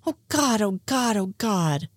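softened, sinking "oh, God" chant; mortified. Acted. Scully learns Mulder told Frohike, mortified.

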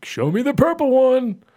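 put-on high, loud, slow childish demand; mock little kid. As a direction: whine like a small boy wanting the purple one.

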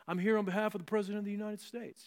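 quick, pompous envoy voice; mock official announcement. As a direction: puff up like a government official. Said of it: "It would be like me saying".

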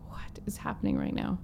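whispered, stunned disbelief; awed whisper. Retold or imitated. Retelling his shock at a stranger's reward offer, whispered. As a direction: breathe it out in amazement.

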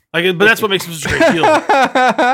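laughing. Real, not acted. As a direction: break into laughter mid-sentence. Laughing at own mock rant.

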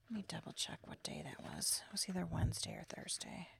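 whispered to herself while checking the date; hushed private aside; muttered aside. Real, not acted. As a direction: drop to a whisper, reading the calendar.

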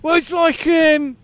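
chirpy Aussie bloke; aussie tradesman. Acted. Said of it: "it's Brian".

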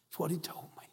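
a breathless unvoiced whisper. Real, not acted. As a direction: drop to a whisper for the aside. Hushed aside after sad quote.

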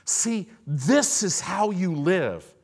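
loud, high, driving pulpit shout; full-force preaching. Real, not acted. Preacher urging believers to resist, full-force preaching. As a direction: build relentlessly to a loud final refusal.

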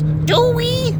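very high, squeaky question; mock the sidekick's worry; ghost doubting. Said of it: "and then he goes".

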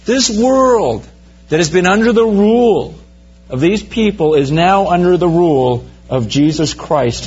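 high, ringing call from heaven; heavenly proclamation. Retold or imitated. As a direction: proclaim it like a host of angels. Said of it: "loud voices from heaven screaming out".

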